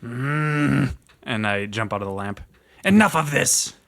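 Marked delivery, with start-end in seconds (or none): 2.8-3.7 shout. Acted. Kobold shouting.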